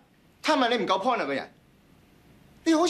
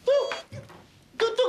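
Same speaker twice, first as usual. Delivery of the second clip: very high card-reader beep; demonstrated beep; much faster than usual. Shown as a demonstration. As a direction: beep it out wholeheartedly. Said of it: "好似我咁喎". (投入咁高音扮嘟聲)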